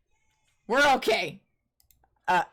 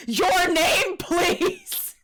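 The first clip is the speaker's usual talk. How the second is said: laughing demand; 5 semitones higher, much slower than usual. Acted. Judge demands name, laughing.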